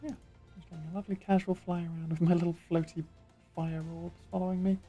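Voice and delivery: ordinary delivery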